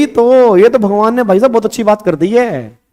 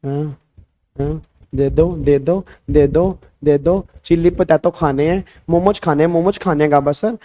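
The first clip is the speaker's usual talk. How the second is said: a hint of a pleading child, soft then back to normal; imitating a child; 3 semitones lower, 5 dB quieter than usual. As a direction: mimic a student pleading for the cash. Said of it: "एक बच्चा देखो कह रहा".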